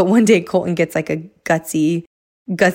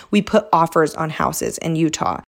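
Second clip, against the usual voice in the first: ordinary delivery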